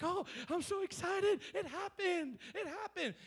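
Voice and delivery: high, giddy imitation of thrilled disciples; mock excited disciples. Disciples returning to Jesus, mock giddy and high. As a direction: play the disciples gushing over success. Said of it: "and they would come back".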